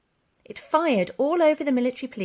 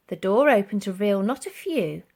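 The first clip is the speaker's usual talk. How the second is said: usual speaking voice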